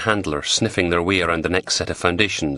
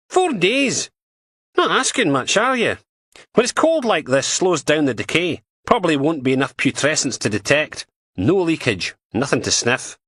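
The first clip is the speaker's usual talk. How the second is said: higher, brisk Scottish policewoman voice; PC Martin voice; 9 semitones higher than usual. Acted. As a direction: voice her as a blunt, scornful dog handler. Martin doubts the search.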